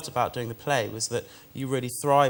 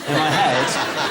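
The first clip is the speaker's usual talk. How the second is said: laughing; 5 dB louder, much slower than usual. Real, not acted. Laughing at the memory.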